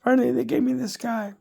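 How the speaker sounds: normal talking voice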